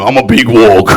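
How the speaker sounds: low, put-on big-man voice; mock macho bloke. Comic mimicking a man in the crowd, mock macho. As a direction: introduce yourself as a lumbering tough. Puts on audience member's voice.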